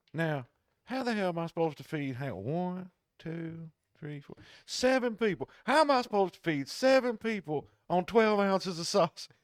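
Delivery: high, loud mock rant; a furious customer ranting, pitched high and loud; going off. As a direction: imitate an outraged caller going off. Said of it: "He's just going off".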